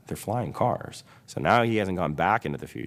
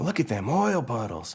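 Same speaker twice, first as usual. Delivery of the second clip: higher, delighted country truck; Mater; 6 semitones higher than usual. Acted. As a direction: point out a find in character. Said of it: "He's all".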